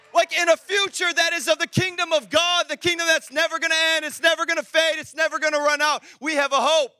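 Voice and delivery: emphatic preacher, punching out each phrase; full-force preaching. Real, not acted. Preacher testifying about being found by Jesus, full-force preaching.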